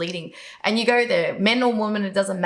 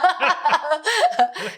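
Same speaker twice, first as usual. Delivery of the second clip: laughing burst; 9 semitones higher than usual. Real, not acted. Laughs at own toilet story.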